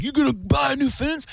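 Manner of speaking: high mock angry dad; squeaky scolding dad impression. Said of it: "And my dad would be like".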